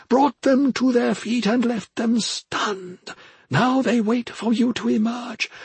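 high, measured spirit voice urging departure; solemn old mentor. Acted. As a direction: intone it from on high, drawing out key words.

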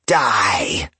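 light hag screech; ghostly screech. Acted. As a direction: spit it like a vengeful ghost. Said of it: "She screeched".

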